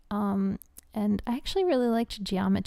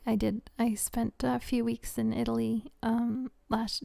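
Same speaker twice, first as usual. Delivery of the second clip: ordinary talk, nothing marked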